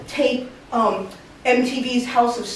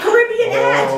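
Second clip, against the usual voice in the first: high-pitched angry accusation, acted out; irate boss; 10 semitones higher, 3 dB louder than usual. Boss finds dirty word in ads, mock outraged tirade.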